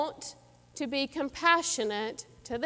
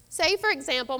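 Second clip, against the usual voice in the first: ordinary talk, nothing marked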